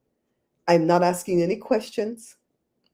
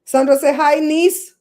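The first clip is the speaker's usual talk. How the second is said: loud, high call across the room to the niece; 7 semitones higher, 7 dB louder than usual. Real, not acted. Calling loudly to the niece to say hi. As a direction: sing out her name so she hears.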